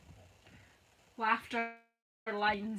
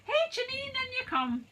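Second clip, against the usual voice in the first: high, bright call of welcome; excited greeting; 14 semitones higher, 4 dB louder than usual. Real, not acted. Host welcoming Janine into the live chat, high excited call. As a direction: sing out a hello to a friend arriving.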